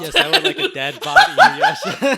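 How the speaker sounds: loud laughing mock request. Real, not acted. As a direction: shout it through laughter.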